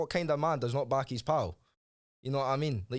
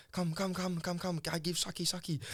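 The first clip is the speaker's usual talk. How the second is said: mock Thai accent; 6 semitones higher, 3 dB quieter than usual. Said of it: "she was like".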